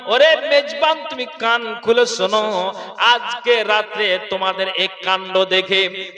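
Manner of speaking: loud, sing-song sermon chant; preaching. Acted. Loud preaching, Prophet's words.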